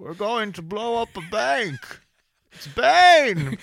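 muffled villain voice, laughing through it; Bane voice. Acted. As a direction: hands over mouth, big Bane boom. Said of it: "to do the Bane".

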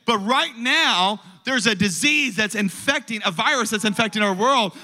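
high, impassioned sermon delivery; fervent preaching. Acted. Preacher telling the distanced to get close to Jesus, fervent high-pitched preaching. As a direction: preach with mounting urgency and conviction.

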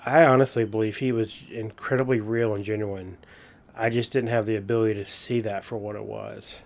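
ordinary talk, nothing marked

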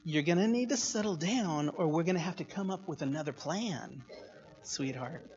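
gentle ultimatum through a smile; smiling motherly warning. Retold or imitated. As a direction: imitate mom's sweet, quiet threat. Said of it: "that's exactly how she said it".

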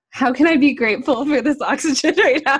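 amused self-quote. Retold or imitated. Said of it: "I'm like".

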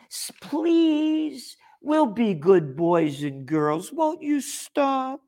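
high, whiny begging, hammed up; mock pleading. Mocking cowed citizens begging the government thief, high whiny plea. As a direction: whimper like a cowed child asking the thief nicely.

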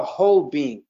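deliberate emphasis on "whole being"; stress on whole being. Shown as a demonstration. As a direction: lean on "whole being", each word weighted. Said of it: "Note, I'm stressing the whole being".

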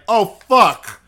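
loud startled oh fuck; mock startled curse. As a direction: blurt it out, caught off guard. Imagined brother in wrong club.

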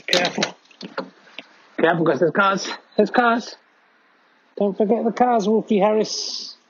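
high, sing-song warning to a pet; talking to a dog. Real, not acted. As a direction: call the dog, high and sweet. Warning the dog about cars.

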